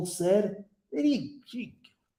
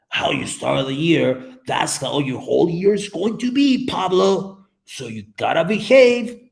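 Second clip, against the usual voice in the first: imitating a lecturing mother, rising and louder toward the end; mom's admonishing voice; 5 dB louder than usual. Mom telling young child how to start the year, admonishing. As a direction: hammer it home like a mom warning a child. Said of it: "my mom used to tell me when I was a kid".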